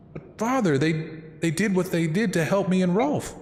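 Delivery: put-on high, pleading young girl. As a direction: beg your father in a high voice. Voices the young sister, Mist.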